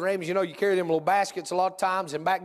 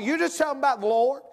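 higher, urgent preacher push; fervent preaching; 6 semitones higher, 3 dB louder than usual. Acted. Preacher urges church higher and fervent. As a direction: drive the exhortation home.

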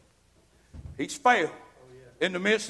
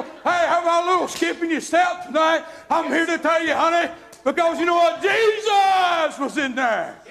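full-force preaching; 5 semitones higher than usual. Acted. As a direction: preach it up to a shout.

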